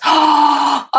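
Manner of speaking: sharp delighted gasp, loud and high; excited gasp. Real, not acted. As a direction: gasp in real surprise at the good news. Friend's mail arrives, excited.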